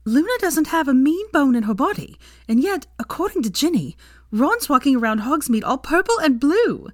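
high, eager gossip; delighted and bubbling over; gleeful gossip to Draco. A narrator's reading. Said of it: "with glee".

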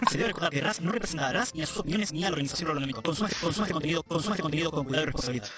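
rapid formal legal warning; fast disclaimer read. Shown as a demonstration. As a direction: rattle off the disclaimer quickly. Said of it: "Quiero leer una cosa por las dudas".

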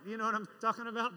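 usual speaking voice

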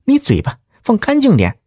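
high, sharp scolding; stern rebuke. Acted. Wife rebukes the lout, sharp and stern. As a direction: snap at him coldly. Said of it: "板着脸说".

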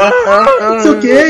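high-pitched mock yelp of pain; imitated pain. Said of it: "e doendo pra caramba". (imitando grito de dor, agudo)

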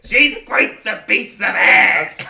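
high, loud, slow parrot squawk; Iago parrot voice. Acted. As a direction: drag it out in a screeching parrot voice. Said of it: "work on a Iago the parrot impression".

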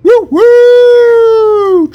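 high loud train whistle whoop. Pretend. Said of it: "That's the excuse train".

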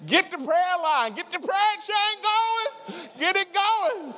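very high, drawn-out comic plea; mock panic. Preacher begging for the prayer chain, high mock-panicked wail. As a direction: wail urgently in pretend terror. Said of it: "if I were in that situation".

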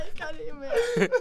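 breathless giggling speech; laughing. Real, not acted. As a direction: laugh through the words. Group laughing fit.

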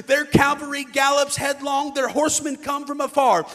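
loud, driving preacher's reading; forceful scripture reading. Acted. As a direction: hurl the verses at the congregation. Reads God's threat with full force.

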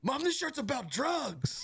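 high, slow, drawn-out mock outrage; scandalized daughter. Daughter telling mom about the shirt, imitated scandalized cry. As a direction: imitate an appalled teenager, stretching it out. Retold shocked daughter to mother.

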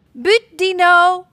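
high, slow, loud call of buut; calling out the win at hide and seek; a child shouting buut; gleeful tag call. Acted. Tagging Dino in hide and seek.

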